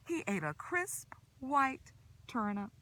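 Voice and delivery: plain reading voice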